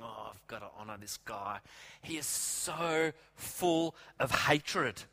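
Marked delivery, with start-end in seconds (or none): 0.0-1.6 low, soft grudging grumble; grudgingly, teeth clenched and voice dropped; through gritted teeth. Acted. Haman forced to honor his rival, through gritted teeth. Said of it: "Haman's gritting his teeth".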